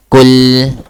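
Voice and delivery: KUL, short u and held l, loud; correct pronunciation. Shown as a demonstration. Teacher modelling Arabic word for student, correct pronunciation. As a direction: hit KUL and hold its L. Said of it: "Practice this".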